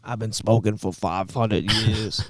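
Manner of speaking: put-on slow, rough old smoker voice; smoker's voice. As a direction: croak it like a lifelong smoker. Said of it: "Just, just that smoker's voice".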